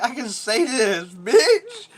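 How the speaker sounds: high, loud, drawn-out comeback; mock bratty retort rising to a squeal; retold childish defiance. Acts out his defiant childhood retort.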